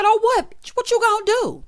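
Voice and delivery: high, loud acted comeback; mock confrontation. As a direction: imitate someone squaring up for a fight. Acted quarrel retort.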